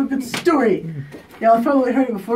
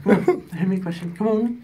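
ordinary talk

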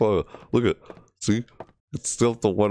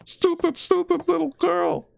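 high-pitched furious insult at the doll; high angry shriek. Real, not acted. Gamer cursing the little girl doll, high angry shriek.

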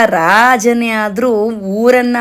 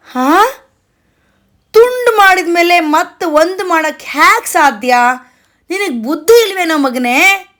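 high, incredulous father's rebuke; lightly acted cross dad; exasperated scolding; 7 semitones higher than usual. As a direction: scold the child in mock disbelief. Exasperated Akbar scolds crying child.

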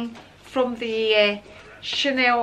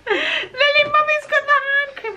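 much higher, tearful with joy; overjoyed call; 11 semitones higher, 4 dB louder than usual. Real, not acted. Mother telling Lily she got the hand cream, tearfully overjoyed. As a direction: call it out near tears of happiness.

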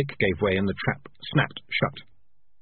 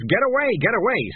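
blurted high and a little louder; sudden outburst; 11 semitones higher, 4 dB louder than usual. Acted. Cat faking a fit to warn the girl, sudden outburst. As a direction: snap it out like a twitchy tic.

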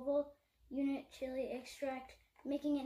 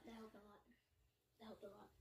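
much softer, muttered repeat; muttered sarcasm; 5 semitones lower, 23 dB quieter, faster than usual. Real, not acted. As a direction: mutter it under your breath.

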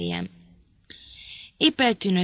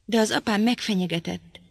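ordinary delivery

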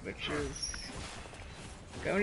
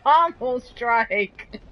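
laughing outburst; 7 semitones higher, 9 dB louder, much slower than usual. Real, not acted. Character gets knocked, player cracks up.

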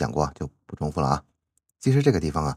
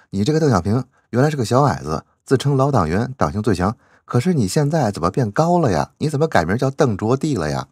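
mock scolding; 6 semitones higher, 5 dB louder than usual. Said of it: "习主席直接对邓小平说话".

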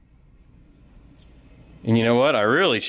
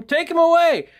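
much higher, louder mock crowd shout; crowd yelling; 3 dB louder than usual. Crowd yelling to take Paul away, a high mock shout. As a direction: shout like the mob demanding jail. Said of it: "they're yelling and screaming".

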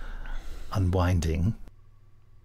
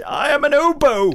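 much higher, louder silly voice; high loud mock voice; 7 dB louder than usual. Host jokes in a loud high voice. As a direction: trumpet it like an oboe.

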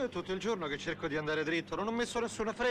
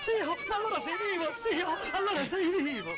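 joyful shout; 9 semitones higher than usual. Acted. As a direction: shout it out, thrilled he's alive. Overjoyed at finding uncle alive.

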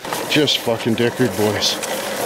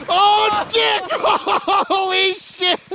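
laughing; 11 semitones higher, slower than usual. Real, not acted. Laughing at the bike crash.

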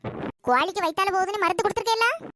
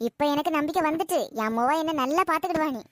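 normal talking voice

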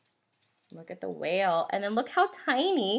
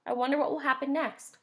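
ordinary delivery, nothing marked

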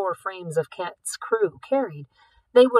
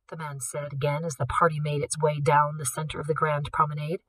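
ordinary delivery, nothing marked

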